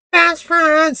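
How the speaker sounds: high falsetto sing-song; mock high catchphrase voice; put-on catchphrase. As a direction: squeal it like a catchphrase.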